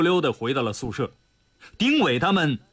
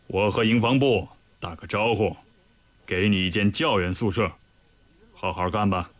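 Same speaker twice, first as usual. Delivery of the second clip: put-on low, measured old commander; dean's voice; 9 semitones lower than usual. A narrator's reading. The dean reassigning Li Yunlong, low and measured. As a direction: speak low and steady like a senior officer.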